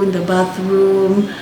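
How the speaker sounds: ordinary delivery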